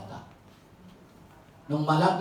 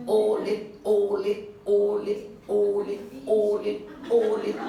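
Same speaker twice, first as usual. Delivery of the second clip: louder, hammering each ulit; repeated emphasis; 2 semitones higher, 3 dB louder, much slower than usual. Acted. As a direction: pound out ulit one by one.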